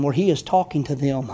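usual speaking voice

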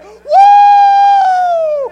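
long loud high whoop; joyful woo. Acted. Preacher reliving his salvation, long joyful whoop. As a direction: whoop for joy. Shouting church joy.

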